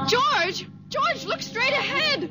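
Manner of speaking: urgent frightened cry; alarmed call to her husband; panicked warning. Acted. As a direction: warn the driver in panic.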